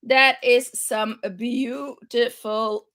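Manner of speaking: ordinary talk, nothing marked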